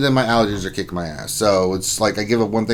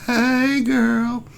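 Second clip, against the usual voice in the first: put-on much higher, girly "Hey, girl"; sassy hey girl; 12 semitones higher than usual. Streamer greets Lady Pool Panda in a high sassy voice. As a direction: squeal it like a best friend.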